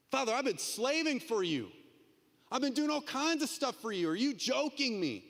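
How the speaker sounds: protesting, pitched well up; self-justifying complaint; indignant older son. Retold or imitated. Older son arguing with father, indignant and high.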